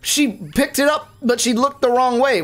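high-pitched frustrated outburst; real exasperation. Real, not acted. Streamer fuming that Amy looked the wrong way, exasperated. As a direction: complain in disbelief, voice shooting up. Exasperated at game character's mistake.

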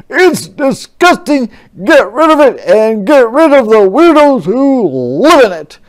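loud, high disgusted bellow; angry roar. Acted. General ordering the army from his window, roaring. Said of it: "he roared from his window".

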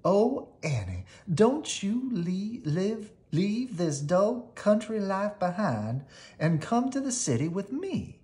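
high, slow, pleading mouse voice; coaxing city mouse. A narrator's reading. Melissa urges Annie to leave the country, high and slow. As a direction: coax her friend sweetly to come away.

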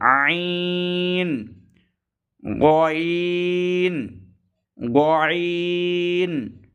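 আইন drawn out long and level; three-count stretch. Shown as a demonstration. Teacher stretching আইন slowly. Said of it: "তিন আলিফ".

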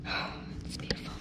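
quick breathy whisper of delight; awed whisper. Real, not acted. Angler marvelling at the fish, in a quick whisper. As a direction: whisper it in wonder.

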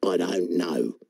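very low, dour frog voice; unamused frog. Acted. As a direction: answer low like a bored frog.

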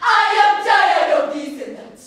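declaimed burst of frustration; exasperated outcry. Acted. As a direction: cry out in protest, begging to be rescued. Recited outburst of weary protest.

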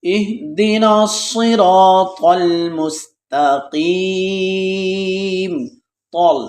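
slow, drawn-out tajweed chant; slow melodic Quran recitation. Shown as a demonstration. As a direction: intone each word slowly. Teacher recites Quran slowly.

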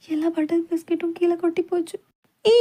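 ordinary delivery

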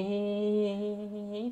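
thin high held note, kept soft; mimicking straining eyes. Shown as a demonstration. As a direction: stretch it out on one high pitch. Mimics eyes straining while half shut. (kéo dài tiếng "hí" cao và nhỏ)

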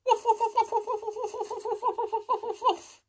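high yappy puppy barks; happy barking. Pretend. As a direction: bark like an excited puppy. Puppies barking happily.

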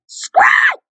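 shouted curse at the game; angry yell. Real, not acted. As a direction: yell the name in fury. Rage at the game.

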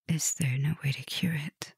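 a fearful question, breathed very softly; hushed whisper. Acted. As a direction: barely voice it, scared of the answer. Frightened question about a cure.

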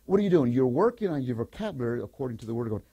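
ordinary delivery

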